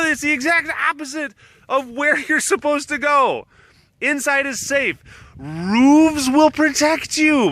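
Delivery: high-pitched mock wailing; imitated frantic shouting. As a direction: plead at the top of the voice, hammed-up. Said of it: "And Heidi was like".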